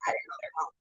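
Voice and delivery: a sudden unvoiced whisper; hushed aside. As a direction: drop to a whisper as if hiding. Aside while fleeing police.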